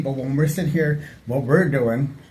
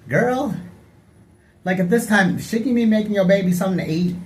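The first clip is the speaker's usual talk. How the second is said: high sassy call-out; sassy scolding; 7 semitones higher than usual. Retold or imitated. Calling out a gossiper online, high and sassy. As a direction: scold her with attitude, pitched up.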